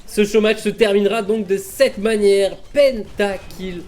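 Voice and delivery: shouted, high-pitched hype call; esports caster. Real, not acted. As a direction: yell the pentakill to the crowd. Caster calls the pentakill.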